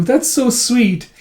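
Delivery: high, slow, drawn-out cooing; acted gushing. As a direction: coo over a sweet gift. Wife gushes at flowers.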